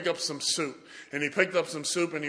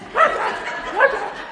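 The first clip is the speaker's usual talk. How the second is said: loud, high-pitched mock shriek; hammed-up holler to catch someone's attention; imitated frantic calling; 9 dB louder than usual. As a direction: yelp the name out, high and loud. Running woman calls to him.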